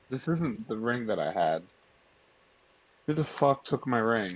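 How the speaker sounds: ordinary talk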